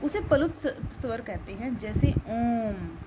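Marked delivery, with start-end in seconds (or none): 2.1-3.0 ओम drawn out long and slow, lower; prolonged pluta vowel. Shown as a demonstration. Teacher showing students a triple-length vowel, ओम held long. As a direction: stretch the vowel of ओम into one long hum. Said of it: "आप बोल के देखिए".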